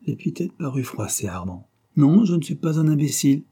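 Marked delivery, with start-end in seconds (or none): 1.9-3.4 higher, louder, offended denial; indignant protest. A narrator's reading. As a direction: push back firmly, stung by the insult. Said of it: "protesta-t-il".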